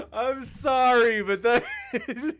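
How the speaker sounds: high, drawn-out words through real laughing; loud, squeezed out between laughs; helpless laughter. Real, not acted. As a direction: apologize while cracking up. Host cracks up at guest's insult.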